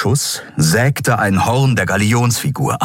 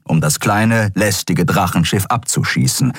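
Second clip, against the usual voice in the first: plain reading voice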